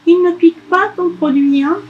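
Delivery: put-on high, anxious child voice; worried elf. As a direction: ask nervously. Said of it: "Le lutin s'inquiète".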